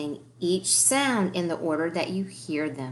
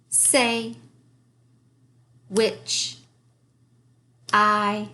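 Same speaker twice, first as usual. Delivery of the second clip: reading aloud; 3 semitones higher, much slower than usual. Shown as a demonstration. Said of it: "Let's say them together".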